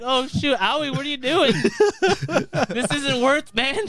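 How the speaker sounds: mock alarm. Acts out alarmed plea to character.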